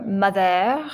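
"mother" with a French accent, stressed on the end; light French accent. Shown as a demonstration. Teacher offering learners another way to say a name, light French accent. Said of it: "say it with a little French accent".

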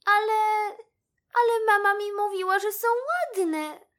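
put-on high, whimpering child-like protest; saddened little mouse. A narrator's reading. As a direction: protest hurt, stretching the last words. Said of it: "posmutniała". (smutny, wysoki głosik myszki)

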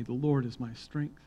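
ordinary talk, nothing marked

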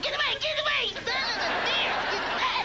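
shrill-pitched woman shooing him; high mock shriek. Acting out a woman rejecting him.

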